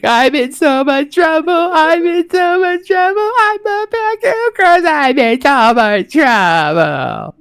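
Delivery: high, slow, wailing sob voice; imitating sobbing child. Dad mimics daughter's sobbing apology, high and slow. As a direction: wail it like a crying little girl. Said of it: "I was just like".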